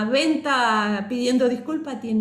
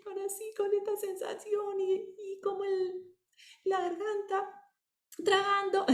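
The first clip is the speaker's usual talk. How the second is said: choked, tearful voice, much higher and much softer, swallowing hard; crying voice; 10 semitones higher, 7 dB quieter than usual. Shown as a demonstration. Teacher demoing how speaking while crying sounds, choked and tearful. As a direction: talk as if holding back tears, throat tight. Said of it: "No es lo mismo hablar llorando".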